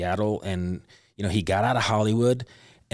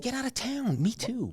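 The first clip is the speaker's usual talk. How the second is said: playful amazement; 4 dB quieter than usual. Real, not acted. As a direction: squeal it lightly in surprise. Mock amazement at shared background.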